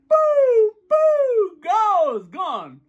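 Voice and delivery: very high whoops swooping down to gone; falsetto delete noise. Shown as a demonstration. Teacher deleting a person from the brain, falsetto whoops. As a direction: whoop high, then let it fall away.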